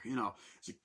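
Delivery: normal talking voice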